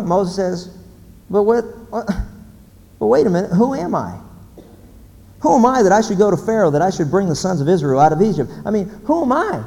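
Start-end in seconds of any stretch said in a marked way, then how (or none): 1.3-9.6 stammering, higher-pitched objection; raised, incredulous self-doubt; flustered protest. Acted. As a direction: balk at the order in disbelief. Moses balks at God's order.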